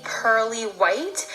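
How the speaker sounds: ordinary talk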